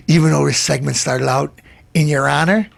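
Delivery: ordinary delivery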